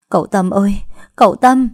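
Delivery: mother calling out to Tâm at night. Acted. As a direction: call a name across the yard.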